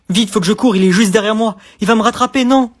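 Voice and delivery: loud, breathless panic; running from something in a dream; panicked flight. Acted. Dreamer fleeing a pursuer, loud and panicked. Acting out nightmare chase.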